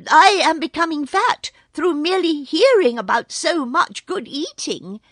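high, loud, fawning jackal yip; a sly jackal gushing in a high yelp; sly yippy jackal. Acted. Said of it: "said the Jackal".

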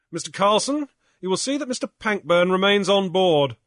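loud, firm captain's order; a skipper barking orders on deck; Grief, stern captain. Acted. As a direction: command the mate curtly.

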